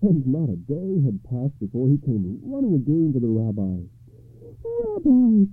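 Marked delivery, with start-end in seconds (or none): 4.6-5.5 high, loud happy call; elated high-pitched greeting; joyful cry. A narrator's reading. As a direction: cry out in delight. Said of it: "cried the poor man".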